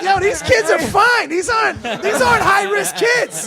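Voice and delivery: loud, high-pitched mock protest; retold protest. Comic retelling his protest to the principal, loud and incredulous. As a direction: push it up high and loud, dragging it out.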